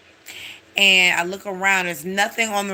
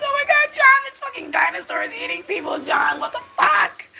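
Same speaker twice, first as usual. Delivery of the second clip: mock freaking out. The wife shrieking at her husband about the dinosaur, hammed-up terror. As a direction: screech it like she's losing her mind. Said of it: "they're freaking out".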